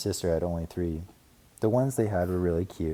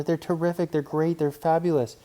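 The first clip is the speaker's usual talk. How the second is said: put-on excited kid; 9 semitones higher, 4 dB louder than usual. A narrator's reading. Brother raving.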